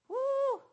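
high, loud whoop of joy; joyful whoop. Real, not acted. Preacher celebrating that God uses flawed people, high joyful whoop. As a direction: let out a celebratory woo. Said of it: "preacher's exclamation".

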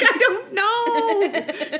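high, drawn-out laughing shrug; laughing helplessly. Retold or imitated. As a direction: stretch it slowly through laughter. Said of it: "I was like".

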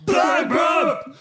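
high, loud jingle yell; loud jingle shout. Shown as a demonstration. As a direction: belt it like a jingle. Retake after countdown.

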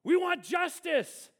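chanted cry for justice, high and loud; demanding crowd. Acted. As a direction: shout it like an angry crowd.

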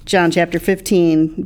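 usual speaking voice

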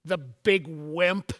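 slow, lightly voiced little boy; a hint of a small child, drawn out; little boy's voice. Son answers mom after a long pause, lightly voiced little boy. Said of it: "the little voice says".

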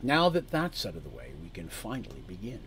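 calm menace, no feeling shown; emotionless cool man. Acted. Man lays out terms to the party, emotionless. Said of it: "He's very emotionless".

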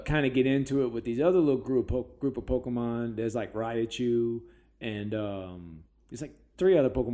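ordinary talk, nothing marked

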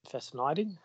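New Zealand-sounding word. Shown as a demonstration. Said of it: "It sounds like I'm from New Zealand".

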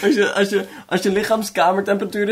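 words squeezed through laughter; laughing. Real, not acted. Laughing at cohost's cold-butt joke, giggling high.

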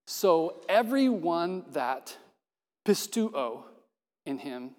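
slow, deliberate word-by-word reading; a slow, strange literal translation; overly literal reading. Shown as a demonstration. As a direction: read it strangely on purpose. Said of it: "translate it like this".

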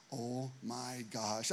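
slow, soft, stunned "oh my gosh"; hushed dread. Retold or imitated. Staff hearing the news, slow hushed dread. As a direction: breathe it out low and slow, half horrified.

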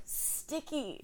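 drowsy, soft mumbled sticky; half-asleep murmur, barely awake; groggy mumble. Retold or imitated. Roommate mumbling sticky half asleep, drowsy mumble. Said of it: "She goes".

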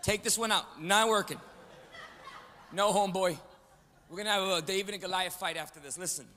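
normal talking voice

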